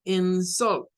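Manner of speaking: "insult" starting weak and going up to a stressed SULT; stress on second syllable. Shown as a demonstration. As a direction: say "insult" as in-SULT, force on the ending. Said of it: "esta primera la debemos de pronunciar como".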